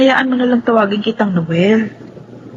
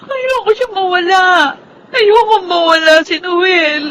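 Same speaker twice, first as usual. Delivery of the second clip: tearful cry, pitch up high; 6 semitones higher than usual. Acted. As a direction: sob out the plea not to lose him. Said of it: "bakit ka umiiyak". (umiiyak, nagmamakaawa)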